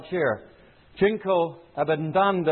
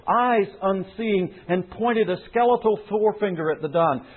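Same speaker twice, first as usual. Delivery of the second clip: ordinary talk, nothing marked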